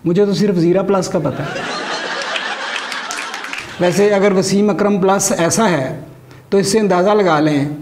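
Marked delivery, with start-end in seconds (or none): none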